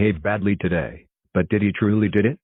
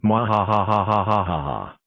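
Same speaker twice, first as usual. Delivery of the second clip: villainous cackling laugh; evil laugh. Acted. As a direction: gloat with a big villain laugh. Villain gloating after prank.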